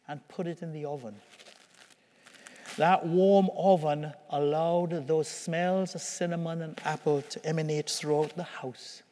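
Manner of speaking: ordinary talk, nothing marked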